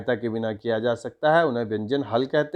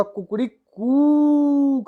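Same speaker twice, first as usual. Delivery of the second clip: drawn-out call; 9 semitones higher, much slower than usual. Shown as a demonstration. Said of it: "जो अंतिम ऊ है, वह बहुत ऊंचा जा रहा है".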